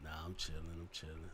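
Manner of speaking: low soft laid-back phone tone; deep smooth voice. Shown as a demonstration. Talking smooth on the phone, very low and soft. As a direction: play it cool and deep. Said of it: "change up his tone".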